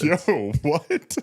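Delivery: high-pitched, incredulous reaction; mock astonishment. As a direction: play baffled shock at a twist. Reacting to the absurd plot twist.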